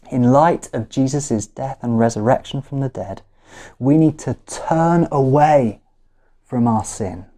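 ordinary talk, nothing marked

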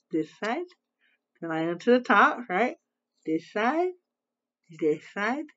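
talking while brushing teeth; toothbrush in mouth. Real, not acted. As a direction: mouth stretched around toothbrush.

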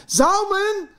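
loud, very high scream of a name; panicked shout. Acted. Mother whose son is not breathing, panicked shout. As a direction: cry out the name in terror.

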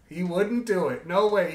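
ordinary talk

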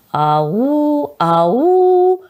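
протяжное громкое «Ау!», высоко; зовите «Ау!» изо всех сил; крик что было силы. A narrator's reading. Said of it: "закричали мы снова, что было силы".

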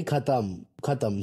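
normal talking voice

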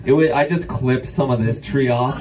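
ordinary talk

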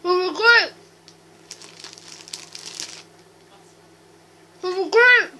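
loud, muffled through a full mouth; cheeks stuffed full. Real, not acted. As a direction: push the words out past a mouthful. Grapes stuffed, straining loud.

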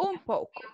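"Un poco" con "un" nasal y suave; "Un" casi como "mp". Shown as a demonstration. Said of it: "Ahí está". ("Un poco" pronunciado nasal y suave)

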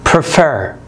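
prefer with FER stressed; stress on last syllable. Shown as a demonstration. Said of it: "المقطع الأخير فيه تشديد".

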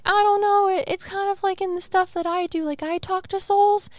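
high, squeaky mock-doubting voice; doubting self. To her cohost, voicing her own doubts, high mock voice. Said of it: "something that I'm like".

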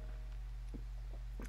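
airless laugh, barely audible; wheezing giggle under the breath; silent wheeze laugh. Real, not acted. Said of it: "I don't know why I laugh like this".

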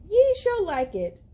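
high, loud herald's boast; grand proclamation. Acted. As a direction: puff it up like a royal herald. Villagers' sign, grand claim.

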